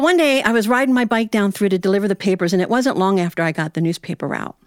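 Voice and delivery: ordinary delivery, nothing marked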